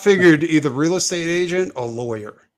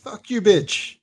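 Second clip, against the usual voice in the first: jokey rude dub; fake-translate the Italian with a sneer; mock dub of the Italian line; mock translation; 6 semitones higher, much slower than usual. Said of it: "let me translate".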